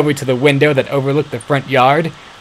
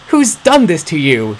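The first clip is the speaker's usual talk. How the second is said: angry question; 6 semitones higher, 4 dB louder than usual. A narrator's reading. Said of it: "she asked angrily".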